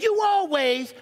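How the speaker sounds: high accusing "you always"; mocking accusation. Shown as a demonstration. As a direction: fling "you always" as a charge. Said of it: "don't say".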